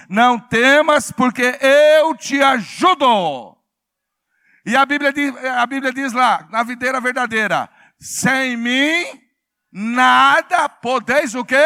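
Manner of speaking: very loud, ringing pulpit delivery; full-force preaching. Real, not acted. Preacher rousing congregation to answer, full-force preaching. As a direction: rouse the congregation with big emphatic phrases.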